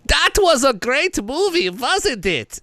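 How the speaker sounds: channeling the director. Retold or imitated. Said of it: "I'm gonna try to channel, the director of this movie".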